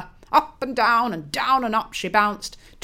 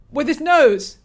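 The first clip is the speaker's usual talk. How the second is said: 1 indignant lover; 6 semitones higher, 8 dB louder than usual. Acted.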